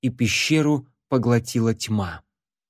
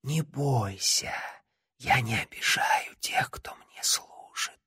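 soft, slow unvoiced whisper; inhuman whisper; 8 dB quieter, slower than usual. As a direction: pleasant inhuman voice breathing words. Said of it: "продолжил приятный, нечеловеческий голос".

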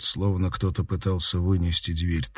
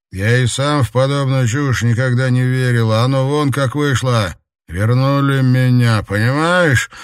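eerie double voice; 5 semitones higher, 7 dB louder than usual. Acted. Voices the two-voiced stranger.

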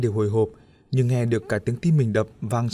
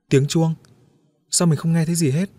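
higher, louder puzzled question; bewildered; 5 semitones higher, 4 dB louder than usual. Acted. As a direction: ask in confusion. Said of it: "Diệu vẫn ngơ ngác". (ngơ ngác hỏi)

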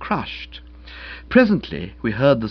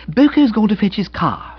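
higher, louder breezy feminine voice; excited young woman; 10 semitones higher, 5 dB louder than usual. Acted. Young woman telling of her fiancé, excited. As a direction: gush the happy news.

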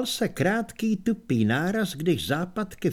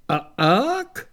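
orangutan grunt; 6 semitones higher, 4 dB louder than usual. Acted. Librarian ape's questioning grunt.

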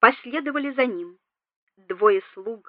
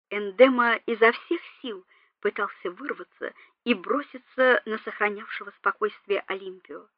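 ordinary delivery

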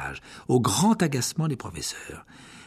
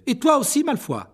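high, loud prefect order; scolding; 13 semitones higher, 4 dB louder than usual. Acted. Percy dispersing the crowd of students, high loud scolding. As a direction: shoo them to class, loudly.

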